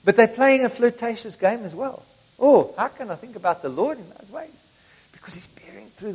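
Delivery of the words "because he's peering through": whispered secretively, like sharing a secret. Acted. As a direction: lean in and whisper it. Secretive peek through window.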